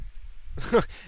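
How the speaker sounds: short breathy chuckle; brief quiet laugh. Real, not acted. Chuckling in the middle of recalling arguments with parents, short laugh.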